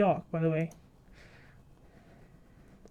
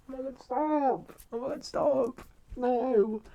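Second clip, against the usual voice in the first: high, drawn-out swoon; touched gushing; 5 semitones higher, slower than usual. Real, not acted. Reacting to being called sweetheart, gushing high. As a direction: gush in a high, stretched-out voice.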